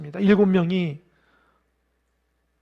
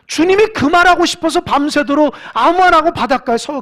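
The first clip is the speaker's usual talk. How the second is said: preaching at full force; 8 semitones higher, 11 dB louder than usual. Acted. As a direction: preach it with a catch of tears in the voice. Sermon climax. (열정적으로 외치는 설교)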